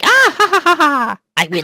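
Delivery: high, squeaky cackle; squeaky cat voice. Retold or imitated. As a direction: cackle like a tiny cartoon cat. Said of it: "just this really squeaky voice".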